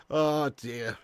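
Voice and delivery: normal talking voice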